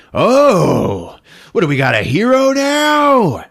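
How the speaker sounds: high, loud mocking challenge; jeering taunt. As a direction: sneer loudly at the would-be hero. [name] taunts Ralph in the fight.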